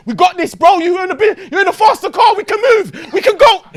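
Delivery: high loud hyped urging; urging the driver on in a chase, loud and hyped; excited urging. Retold or imitated. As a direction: pump the driver up to escape. Urging friend to flee police.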